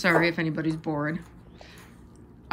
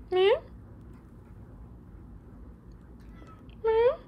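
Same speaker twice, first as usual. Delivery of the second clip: high little cat meow, rising; mimicked meow; 6 dB quieter than usual. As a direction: meow back at the cat playfully. Meowing back at the cat.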